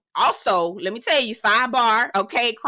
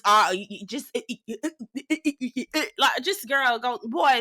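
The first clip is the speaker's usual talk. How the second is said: mock stuttering; 3 semitones higher than usual. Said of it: "Get the stuttering words".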